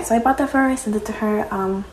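ordinary talk, nothing marked

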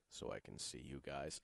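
very low, quiet coach mumble; Bill. Retold or imitated. Coach answering the reporter, low mumble. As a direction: mumble it far down and soft. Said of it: "that asked him a few weeks ago".

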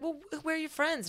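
put-on high questioning girlish voice; mock girl's voice. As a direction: lift into her high curious tone. Parisian girl asking, voiced.

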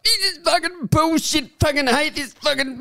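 put-on high, whiny kid's swearing fit; hammed-up sobbing rage over no gold; mock crying tantrum. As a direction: squeal it like a furious nine-year-old. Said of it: "And he starts crying".

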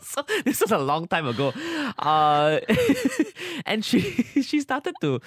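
laughing through the words; giggling, can't hold it in; stifled giggles. Real, not acted. Laughing while telling the story.

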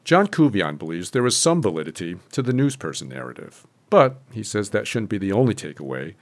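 scripted radio reporter delivery with punched key words; news report read. Acted. Reporter introducing election results to listeners, news read.

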